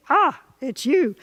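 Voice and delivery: slow, flat, let-down "Ah, it's you"; bored recognition. Shown as a demonstration. Longtime partner at the door, weary unimpressed greeting. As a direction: sound unimpressed to see them. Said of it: "same words, different sparkly bits".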